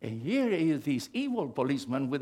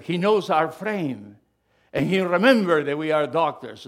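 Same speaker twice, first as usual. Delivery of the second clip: proclaiming scripture; 2 semitones higher, 8 dB louder than usual. Acted. Quoting King David loudly.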